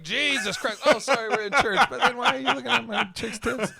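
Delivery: hammed-up outrage at the priest; mock indignation. The boyfriend hamming up outrage at the priest, high and loud. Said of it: "You're like".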